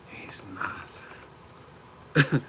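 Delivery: whispered mock Kazakh accent; Golovkin. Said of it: "He's like".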